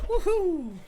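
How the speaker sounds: cheer. Real, not acted. Screw found on the floor.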